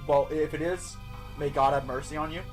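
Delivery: ordinary delivery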